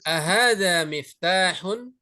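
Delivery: "Ahadza miftahun" slow, rising at the end; question intonation. Shown as a demonstration. Teacher models a yes-no question for students, questioning "Ahadza miftahun". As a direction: ask "Ahadza miftahun" drawn out, with a final lift. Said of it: "Intonasi istifham".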